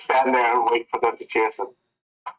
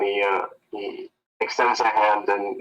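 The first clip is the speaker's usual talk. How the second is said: usual speaking voice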